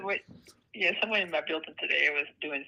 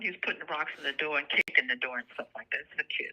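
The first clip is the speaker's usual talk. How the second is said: ordinary delivery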